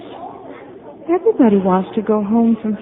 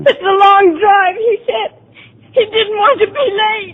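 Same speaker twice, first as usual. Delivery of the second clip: sobbing; 6 semitones higher than usual. Acted. Patient reliving Gordon's words, sobbing. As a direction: sob through the memory.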